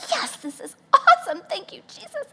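high joyful exclaim; delighted praise. Retold or imitated. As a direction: burst with relief. Inspiration strikes.

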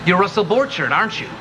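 cartoonish character voice; vintage cartoon voice. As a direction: play an old cartoon character. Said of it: "did the voice of a cartoon ant".

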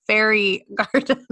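slow "Fairy garden" breaking into a laugh; helpful clarification. Retold or imitated. Co-host imitating the judge stepping in to translate, "Fairy garden" said slowly. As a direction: spell out "Fairy" slowly, amused. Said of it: "Sandy stepped in or Prue and was like".

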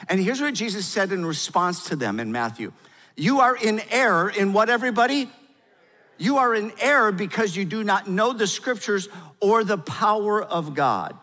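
loud, high, impassioned preaching voice; preaching at full force. Acted. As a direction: proclaim it to the whole room.

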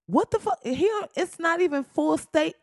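high-pitched, baffled outburst; exasperated disbelief. Real, not acted. Host reacting to Trump's stupid talk, high exasperated disbelief. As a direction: jump way up in pitch, incredulous.

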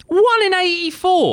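high, stunned question; can't believe the number; shocked disbelief. Real, not acted. Shocked by car-death odds.

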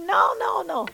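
high, loud no no no; emphatic high-pitched refusal; shrill refusal. Retold or imitated. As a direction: cut them off with a high loud no. Refusing the medication.

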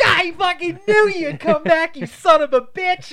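high, loud, drawn-out jubilation; hammed-up ecstatic greeting; overjoyed shout. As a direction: yell it thrilled to see your buddy. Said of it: "He jumped out of the fucking X-Wing so happy".

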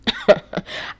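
short voiceless chuckle; knowing laugh. Real, not acted. Vlogger recalling her ex's promise, knowing laugh. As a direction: laugh at a promise just quoted.

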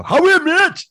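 loud, high-pitched mimicked greeting; mocking accent shout. Friends greeting the speaker's Northeast girlfriend, loud mocking shout. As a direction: holler it as an exaggerated impression. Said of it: "they just go".

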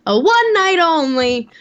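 slow, drawn-out showman voice; old-timey announcer. Pretend. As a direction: announce it like an old-time show. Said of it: "Why are you talking like that old-timey voice".

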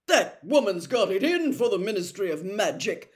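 loud, indignant outburst; furious. A narrator's reading. Percy to his family about Rita Skeeter, loud and furious. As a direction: rant with offended fury. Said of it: "said Percy furiously".

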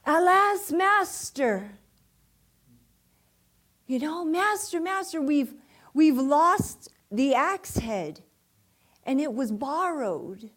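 high, loud cry to the master; acted yell. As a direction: imitate an alarmed yell for help. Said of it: "And so they yell".

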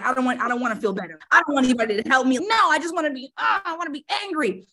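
put-on sulky kid tantrum. Mocking a younger self who wants to stay angry, whiny tantrum. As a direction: whine it fast like a stubborn upset kid. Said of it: "you were like".

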